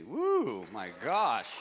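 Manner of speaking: ordinary talk, nothing marked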